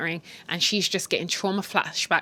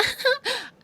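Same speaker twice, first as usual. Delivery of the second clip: giggle. Retold or imitated. Giggling on the phone to lover.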